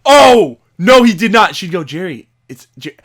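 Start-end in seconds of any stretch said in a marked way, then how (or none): 0.1-1.6 loud, high excited denial; hyped up at the TV; excited disbelief. Retold or imitated. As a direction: gasp it out in hype. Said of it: "I would go".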